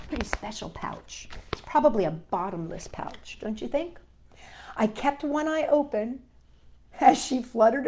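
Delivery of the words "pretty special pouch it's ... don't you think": hushed secret whisper. Acted. Telling kids a secret, whispering. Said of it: "whispered aside to listener".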